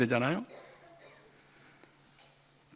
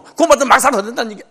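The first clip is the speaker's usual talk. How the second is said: higher, louder, fast mock of the excuse; 7 semitones higher, 7 dB louder, much faster than usual. Preacher repeats the universal excuse, louder mocking voice. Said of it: "이거 세계 공통어".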